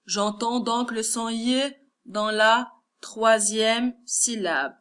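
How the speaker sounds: ordinary delivery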